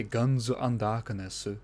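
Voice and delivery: "Gunzu Andakunesu" with every kana syllable voiced, Japanese style; katakana pronunciation. Shown as a demonstration. Showing viewers how the kana sounds, Japanese pronunciation. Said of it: "when I say".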